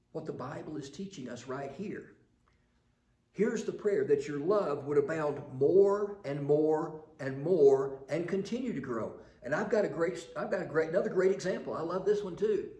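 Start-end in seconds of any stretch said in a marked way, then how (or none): 3.3-12.7 preaching. Acted. Louder preaching burst.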